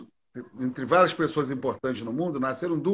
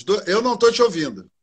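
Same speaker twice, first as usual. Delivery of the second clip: raised voice over a bad line; louder, higher, to be heard; to someone hard of hearing; 5 semitones higher, 6 dB louder than usual. Real, not acted. Can't hear her on the call.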